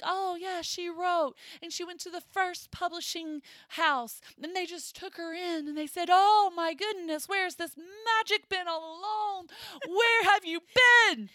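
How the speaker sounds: high, loud, over-the-top delight; mock gushing publisher. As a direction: gush like a thrilled publisher who found a gem. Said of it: "would talk like that".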